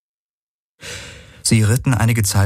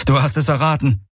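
higher, called over the ride; calling back; 8 semitones higher than usual. Acted. Garland answering Kirai during the chase, high called reply.

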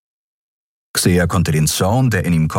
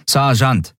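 higher, curt correction; correcting guard; 8 semitones higher than usual. A narrator's reading. The guard corrects Xia curtly, higher. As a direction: set her straight. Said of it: "verbesserte die Frau".

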